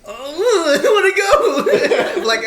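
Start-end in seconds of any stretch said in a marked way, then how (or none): 0.1-2.2 laughing. Retold or imitated. Eager to chase Pokemon.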